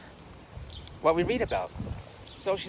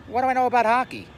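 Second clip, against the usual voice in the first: higher, louder baffled comeback; mock indignation; 6 semitones higher, 5 dB louder than usual. Gambler answers friend's betting advice, mock indignant. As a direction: protest it like an absurd suggestion.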